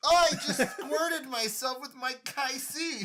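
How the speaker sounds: talking through real laughter; high and slow, laughing it out. Real, not acted. Squirted drink, laughing.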